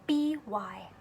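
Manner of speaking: soft hushed letters; whispered spelling for children. Shown as a demonstration. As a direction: breathe out B-Y.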